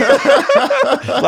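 laughs out loud, high and helpless; can't hold back the laughter; high burst of laughter. Real, not acted. Cracking up at the friend's dry answer, high laughter.

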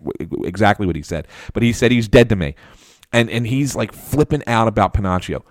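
ordinary talk

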